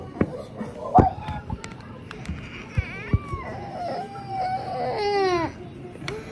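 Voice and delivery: high wailing laugh that sounds like crying. Real, not acted. Brother overwhelmed by his sister undoing cubes, wailing laugh-cry. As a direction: let a half-sob laugh pour out. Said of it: "Why are you crying?".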